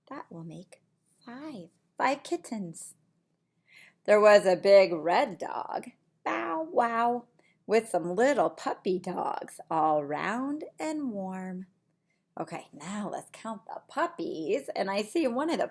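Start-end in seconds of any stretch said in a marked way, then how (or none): 4.1-5.9 lively storybook voice for little ones; reading to children. Acted. Reads picture book aloud. 6.2-7.3 high playful bow wow; dog bark. Acted. Dog in farm picture book. 7.7-11.7 lively storybook voice for little ones; reading to children. Acted. Reads picture book aloud.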